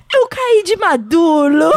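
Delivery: put-on toddler lisp, "maduro" said as "madulo"; slow, drawn-out little-boy announcement; Valentim. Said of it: "ele tropeçou assim".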